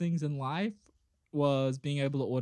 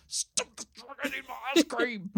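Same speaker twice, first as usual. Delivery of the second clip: put-on childlike shout; 9 semitones higher than usual. Running out in PJs, childish call.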